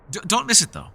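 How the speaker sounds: emphatic stammered warning; eager insistence. Acted. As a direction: press the point eagerly. Stressing meal times warning.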